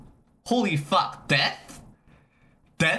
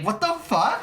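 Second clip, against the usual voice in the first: usual speaking voice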